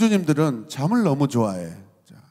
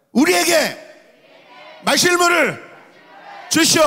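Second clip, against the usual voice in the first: high, loud crowd demand in separate bursts; angry demand; 12 semitones higher, 4 dB louder than usual. Acted. Angry people demand water from Moses, loud shout. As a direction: shout it up high for all to follow. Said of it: "막 화가 나가지고 막 달려들고".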